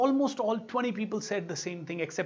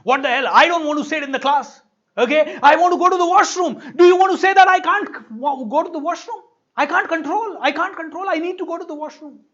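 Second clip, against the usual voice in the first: high, loud furious yelling at the trainer; angry shouting; 9 semitones higher, 11 dB louder than usual. Retold or imitated. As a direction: yell it like he's been cornered. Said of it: "started fighting with me".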